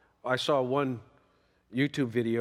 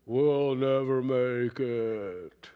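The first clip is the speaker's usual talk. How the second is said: slow, lower, mopey donkey drone; gloomy Eeyore; 3 semitones lower, much slower than usual. Retold or imitated. Preacher imitating Eeyore's pessimism, slow mopey drone. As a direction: drag it out like Eeyore, all doom. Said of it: "he always talks like that".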